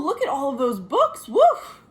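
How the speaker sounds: ordinary talk, nothing marked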